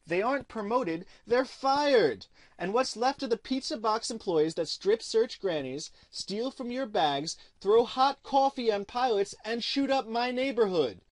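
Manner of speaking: indignant rant. Real, not acted. Narrator denouncing the agency's treatment of good employees, indignant. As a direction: punch out each charge with rising indignation.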